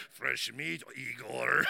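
low, mocking chuckle round the words; laughing Russian men. Russian sauna men to the American newcomer, mocking laughter. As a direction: jeer low between belly laughs. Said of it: "laughing when the American blonde guy comes in".

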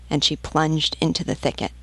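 ordinary delivery

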